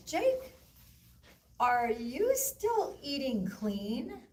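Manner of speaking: high-pitched, drawn-out mom question; slow, high mock-suspicious mom; mom checking up. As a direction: imitate a mother pointedly quizzing her son. Mother questioning her son, acted.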